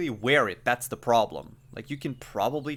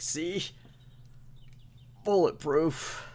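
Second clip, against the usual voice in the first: pained boast; 4 semitones higher, 7 dB louder than usual. Acted. JD proudly shows the pelt.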